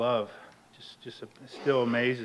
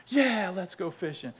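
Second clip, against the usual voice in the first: higher-pitched, keen yes; eager agreement; 6 semitones higher than usual. Retold or imitated. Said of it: "I was all about it".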